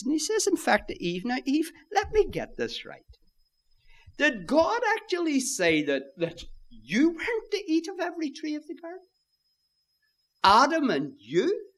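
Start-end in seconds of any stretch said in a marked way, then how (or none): 1.2-11.7 high, incredulous tempter's questioning; devil questioning Eve. Acted. Devil casting doubt to Eve, mock-puzzled questioning. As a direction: feign puzzlement, pitch climbing high into each question. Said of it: "the devil comes along to our first parents".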